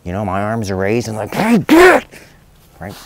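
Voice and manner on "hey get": sharp high yell to scare a dog off; high, loud shout of "Hey, get!"; shouted command at dog. Retold or imitated. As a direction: bark it out to chase the dog away. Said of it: "And I'm like".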